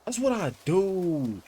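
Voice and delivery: high, slow, drawn-out "That's what I do"; proud crowing. Real, not acted. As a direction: stretch out the boast up high. Boast, then repeated plainly.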